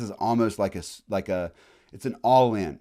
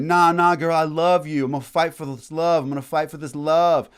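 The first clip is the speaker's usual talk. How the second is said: loud, high-pitched hammed-up lover's plea; pleading jilted lover; 8 semitones higher, 8 dB louder than usual. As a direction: beg your girl not to leave, in mock desperation. British voiced as clinging jilted lover.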